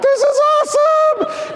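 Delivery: high, loud, stretched-out thrill; gleeful thrill. Retold or imitated. Dad racing down the slope, loud gleeful thrill. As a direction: squeal it out like a kid flying downhill. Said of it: "And I'm like".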